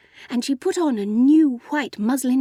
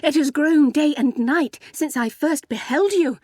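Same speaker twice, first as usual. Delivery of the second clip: louder, higher ardent declaration; fervent; 5 semitones higher, 5 dB louder than usual. A narrator's reading. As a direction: press the declaration with fervour. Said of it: "he added fervently".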